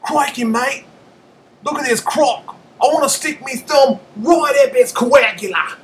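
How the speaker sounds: put-on high, loud over-the-top Aussie accent, shouting like a croc hunter. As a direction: ham up an Australian wildlife host, yelling. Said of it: "Aussie accent".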